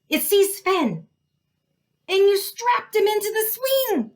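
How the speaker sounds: high, loud frantic cry; panicked cry. A narrator's reading. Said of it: "Rita cried".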